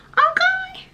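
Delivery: very high falsetto; comic falsetto check-in; squeaky okay. Pretend. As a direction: jump way up into a tiny squeak. Comic high-pitched sign-off.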